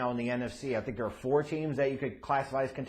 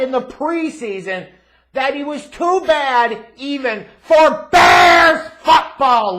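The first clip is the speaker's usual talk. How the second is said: rising rant; 10 semitones higher, 16 dB louder, slower than usual. Real, not acted. Ranting about a loss.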